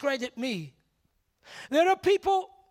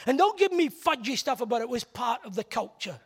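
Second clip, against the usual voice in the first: normal talking voice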